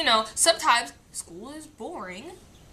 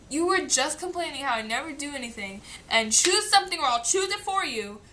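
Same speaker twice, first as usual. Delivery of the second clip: ordinary talk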